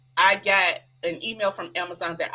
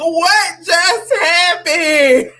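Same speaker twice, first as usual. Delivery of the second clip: high, loud, drawn-out disbelief; mock-stunned yell stretched out; shocked outburst; 10 semitones higher, 11 dB louder, much slower than usual. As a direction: shriek it slowly, as a comic replay of shock. Acted shocked reaction in retelling.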